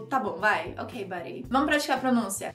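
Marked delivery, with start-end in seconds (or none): 0.0-1.5 low, soft brush-off; dismissive sarcasm. Acted. Someone waving off another person, dismissive sarcasm. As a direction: let it drop low, as if humoring them. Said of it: "parece sarcástico".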